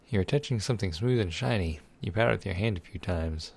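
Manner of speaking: ordinary talk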